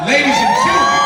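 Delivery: huge hype-man shout; announcer shout. Real, not acted. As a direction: bellow it to the crowd. Announcer hypes crowd.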